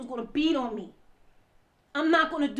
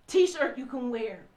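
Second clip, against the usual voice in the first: ordinary talk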